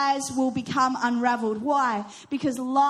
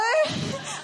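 embarrassed laugh; 10 semitones higher than usual. Real, not acted. Laughs at awkward moment.

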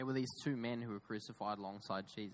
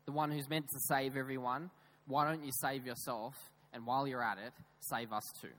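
louder, goading retold taunt; imitated sarcastic challenge, pushed out louder; mocking; 3 semitones higher, 4 dB louder than usual. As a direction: jeer like a doubter demanding proof. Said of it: "was mocking Jesus".

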